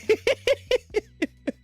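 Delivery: high burst of real laughter. Real, not acted. Streamer cracking up at a chat message, high laugh. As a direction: cackle at the chat's joke.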